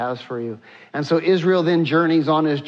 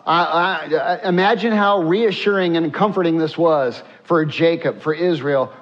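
normal talking voice

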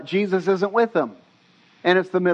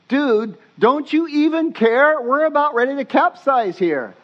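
higher-pitched mock panic; panicked; 8 semitones higher than usual. Disciples waking Jesus in the storm, panicked. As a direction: plead in alarm, acting out a frightened crew. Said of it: "for them to be this panicking".

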